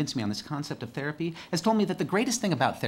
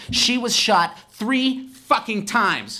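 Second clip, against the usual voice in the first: slow, higher, much louder angry yell; furious shout; 11 semitones higher, 8 dB louder, much slower than usual. Real, not acted. Telling the crowd about his aunt, raging shout. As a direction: hammer out each word in rage.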